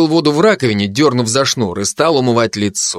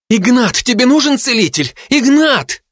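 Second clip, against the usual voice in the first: worried calling; 12 semitones higher than usual. A narrator's reading. Girl calls to hurt boyfriend.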